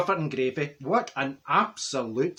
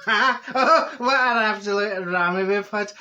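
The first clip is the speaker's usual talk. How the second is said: laughing; 4 semitones higher, 4 dB louder, slower than usual. Real, not acted. Laughing recalling the chaos.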